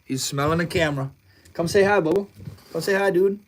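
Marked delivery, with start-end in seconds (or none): 1.5-3.4 pet voice. Real, not acted. Calling the cat over.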